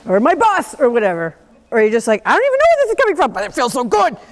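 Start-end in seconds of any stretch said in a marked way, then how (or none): 2.2-4.2 high, fast, carried-away gush; playful mock rush of release; giddy release. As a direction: rattle it off swept up in the thrill. Voicing release while hitting ball.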